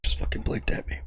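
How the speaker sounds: breathy deadpan whisper. Retold or imitated. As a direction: whisper it in disbelief. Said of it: "whispered deadpan".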